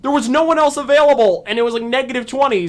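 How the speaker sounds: exasperated pitch rise. Real, not acted. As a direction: push back, fed up. Defending herself, exasperated.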